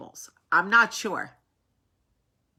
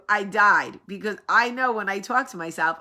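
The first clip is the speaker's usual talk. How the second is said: ordinary talk, nothing marked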